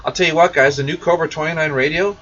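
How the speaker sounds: ordinary delivery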